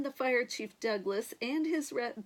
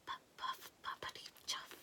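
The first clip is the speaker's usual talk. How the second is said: whispered engine puff; 13 dB quieter, faster than usual. A narrator's reading. Faint tractor sound far off.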